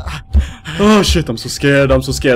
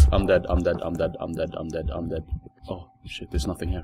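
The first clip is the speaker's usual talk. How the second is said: soft, low panicked murmur; fading repetition under breath; quiet panic; 9 semitones lower, 12 dB quieter, faster than usual. Real, not acted. Hiding, about to die.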